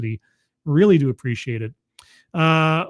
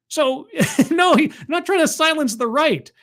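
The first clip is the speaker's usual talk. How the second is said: laughing; 11 semitones higher, 3 dB louder than usual. Real, not acted. Laughing correction.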